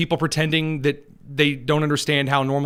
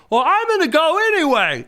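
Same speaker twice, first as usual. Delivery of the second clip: high, bratty sneer at the cops; mock defiance; 11 semitones higher, 3 dB louder than usual. Host mocking a protester who ignores cops, in a high bratty sneer. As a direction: play a smug brat shrugging off the police. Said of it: "and then you say".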